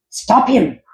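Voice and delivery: high, loud urgent shout. Acted. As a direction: yell the order at the henchmen. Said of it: "Doctor Franken shouted".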